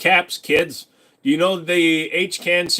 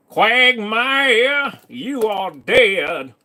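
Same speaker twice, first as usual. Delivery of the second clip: high, slow, drawn-out cartoon dad voice; a hammed-up cartoon voice, much higher and slow; Cleveland voice; 8 semitones higher, 4 dB louder, much slower than usual. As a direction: stretch it out like the cartoon neighbor. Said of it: "you sounded like Cleveland".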